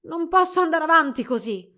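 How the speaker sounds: high, loud wail of exhaustion; strained, high complaint; exhausted groan. A narrator's reading. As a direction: cry out at the end of your strength. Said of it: "emise un gemito sfinito".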